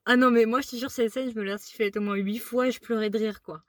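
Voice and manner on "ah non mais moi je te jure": ordinary talk, nothing marked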